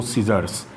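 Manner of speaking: सीजर्स starting with a plain s; no k after s in सीजर्स; silent C. Shown as a demonstration. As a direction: say सीजर्स as "see-zers". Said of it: "को सीजर्स".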